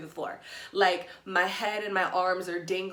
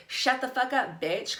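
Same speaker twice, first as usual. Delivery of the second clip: higher mock yell, imitating the woman; retold angry snap; 6 semitones higher, 3 dB louder than usual. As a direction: play her telling you to shut up, half-acted. Woman yells at crying narrator.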